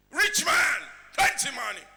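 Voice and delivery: high, loud exclamation to the crowd; emphatic shout. Real, not acted. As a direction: shout out how rich he is. Preacher stresses the man's wealth.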